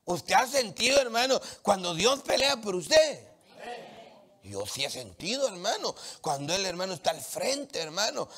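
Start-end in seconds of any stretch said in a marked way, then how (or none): 0.0-3.2 louder, higher push to the crowd; insistent, rousing question. Real, not acted. Pastor unconvinced by congregation's response, rousing louder question. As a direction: ask it forcefully, demanding a convinced answer. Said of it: "no me convence".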